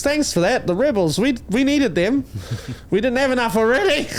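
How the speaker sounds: sarcastic. Real, not acted. Sarcastic thanks to Australia.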